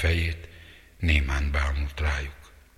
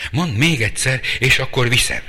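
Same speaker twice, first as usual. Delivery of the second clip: defiant challenge; 8 semitones higher, 7 dB louder than usual. Acted. Sister challenging brother János.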